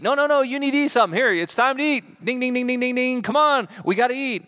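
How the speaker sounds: fast, high, insistent no-no-no with a ding-ding dinner bell; urgent nagging. Pretend. Disciples urging Jesus to stop and eat, insistent. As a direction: fuss like friends hurrying someone to lunch.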